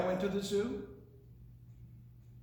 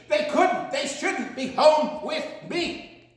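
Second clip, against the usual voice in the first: louder, higher, racing through the animal list; excited build; 4 semitones higher, 6 dB louder than usual. Acted. Reading to kids, building to a loud excited climax. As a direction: pile on the excitement to the end. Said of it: "You can tell I'm getting excited".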